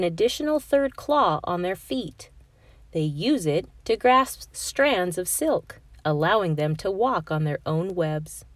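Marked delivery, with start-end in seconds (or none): none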